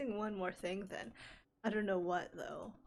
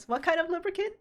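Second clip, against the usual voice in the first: incredulous; 8 semitones higher, 9 dB louder, faster than usual. Real, not acted. Incredulous at item name.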